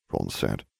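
plain reading voice

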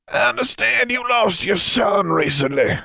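put-on high, thin voice of a cold old wizard; curt greeting from a hostile old man. Old wizard coldly greeting Miss King, high thin voice.

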